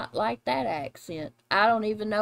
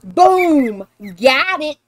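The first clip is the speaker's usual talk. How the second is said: loud, high victory shout; triumphant; 3 semitones higher, 8 dB louder, much slower than usual. Real, not acted. Celebrating the solved lyric with a friend, triumphant. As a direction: call it out like a winning hit.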